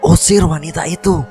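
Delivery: high-pitched furious order. A narrator's reading. Enraged apparition staring at the girl, high furious command. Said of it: "sepertinya dia sedang marah besar".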